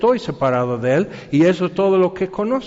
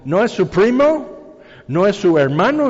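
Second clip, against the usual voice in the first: put-on Texan accent; 4 semitones higher, 4 dB louder than usual. Preacher imitating his Texan friend at the parts store, Texan accent. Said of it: "con su acento muy tejano".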